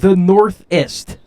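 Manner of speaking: drawn-out, slow mock of "northest"; mocking repeat. Host mocks his cohost's made-up word, slow and teasing. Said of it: "northest is not a word".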